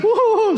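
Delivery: high hammed-up woo-hoo-hoo crying; mock sobbing. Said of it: "she starts crying".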